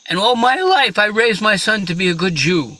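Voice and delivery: high, loud lament of a father; aggrieved old rabbi. Acted. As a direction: pour out a hammed-up complaint to heaven. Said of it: "The rabbi says".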